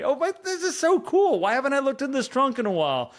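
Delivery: high, bright burst of delight; delighted discovery. Pretend. To listener, opening an old trunk, delighted rediscovery. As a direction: gasp with joy at an old find.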